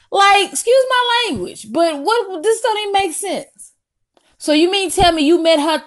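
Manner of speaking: rising outraged disbelief; exasperated scolding. Retold or imitated. Scolding the boy who lied.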